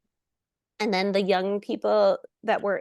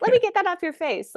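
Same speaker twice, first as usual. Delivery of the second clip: higher, a light doting mom voice; fussing mom; 10 semitones higher than usual. Pretend. Actress showing the mom roles she suits, fussing higher. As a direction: play the fussy mom wiping a kid's face. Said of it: "I'm much better as a mom".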